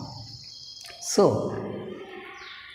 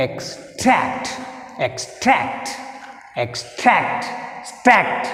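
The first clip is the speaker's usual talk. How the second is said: "extract" split as ex, TRACT, the second part higher; stress on second syllable; 4 semitones higher, 3 dB quieter, much slower than usual. Shown as a demonstration. Teacher giving another example, stressed TRACT in extract. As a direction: hit TRACT after a short break in "extract". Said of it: "So second syllable is tract".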